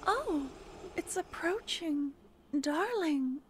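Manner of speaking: light up as you notice it coming; sudden excitement. Acted. Sudden thrilled realisation mid-roleplay.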